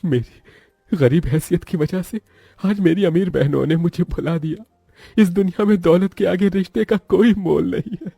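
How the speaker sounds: high, tearful lament; acted sorrowful monologue; heartbroken weeping. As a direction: lament in a high, crying voice, rising as it goes. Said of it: "Mahesh का दिल ढहक ढहक कर रो देता है".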